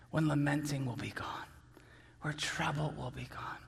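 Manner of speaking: low, soft, tender litany; hushed preaching. Real, not acted. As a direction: let it settle into a quiet, reverent close. Hushed close of sermon point.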